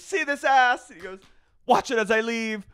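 high, loud diva sass; sassy exit. Pretend. Star flouncing out of reunion, high sassy taunt. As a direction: flaunt it as you walk out.

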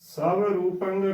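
ਸਵ ਰੂਪੰ with "sav" for "so"; ਸਵ ਰੂਪੰ opening on an "a" and a v; mispronunciation demo. Shown as a demonstration. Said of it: "ਕਈ ਇਸ ਦਾ ਵੀ ਉਚਾਰਨ ਗਲਤ ਹੀ ਕਰ ਦਿੰਦੇ ਨੇ".

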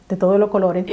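plain reading voice

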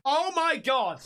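mounting horrified astonishment; shocked, building. Real, not acted. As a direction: start low and build with each repeat. Horrified reaction to devil clip.

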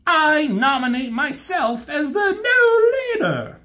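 very high, shouted self-nomination; high shouting scheming robot claiming power; Starscream voice. Retold or imitated. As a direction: crow it out like a triumphant usurper. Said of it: "it's like".

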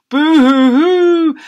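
high, slow, loud mock wail; baby boo-hoo crying. Baby wailing at birth, loud hammed-up boo-hoo. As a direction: bawl it out like a huge baby. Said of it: "burst into tears too".

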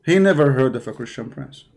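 ordinary delivery

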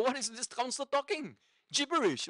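high-pitched, alarmed bewilderment; mock frightened client. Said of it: "you frighten the daylights out of them".

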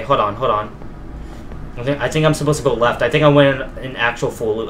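usual speaking voice

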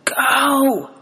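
a choked, tearful cry with her final breath. Acted. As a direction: force one last word through a grip on the throat. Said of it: "managed to choke out one last word".

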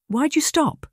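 put-on high-pitched child's question, pitch leaping up; curious girl. A narrator's reading. Girl asks her governess why she stopped, high and curious. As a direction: ask it as a puzzled little girl, high and light. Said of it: "Julia asked, her head tilted".

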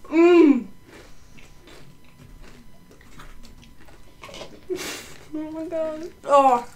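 high pained moans; burned tongue. Real, not acted. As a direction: moan through the heat. Eating the spicy chip.

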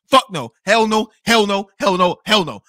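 loud, repeated flat-out refusals; emphatic refusal. Retold or imitated. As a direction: fire off each refusal like a punch. Acted refusal of her demand.

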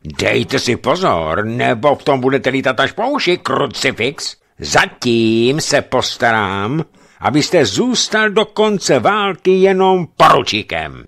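enraged officer threatening, slow and loud; furious threat. A narrator's reading. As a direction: threaten him with every word.

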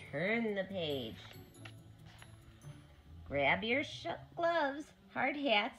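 ordinary delivery

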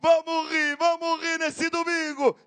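high, drawn-out showman's call; TV host catchphrase. Real, not acted. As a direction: sing out the Sunday show slogan, stretched.

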